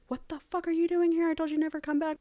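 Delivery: high-pitched, put-on outraged scolding; mock aggressive. King confronting her on her return, hammed-up high indignant voice. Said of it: "But he wasn't that aggressive".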